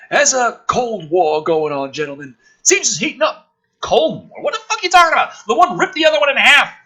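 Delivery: ordinary talk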